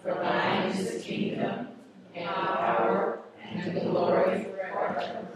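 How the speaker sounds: whispered close to the Lord's Prayer. Acted. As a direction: breathe the closing words in a reverent whisper. Prayer ending dropped to whisper.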